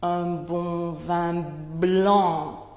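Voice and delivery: un bon vin blanc said very slowly, each nasal vowel held; slow nasal demo. Shown as a demonstration. Teacher contrasting the four nasal vowels, very slow. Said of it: "En ce qui concerne le an de".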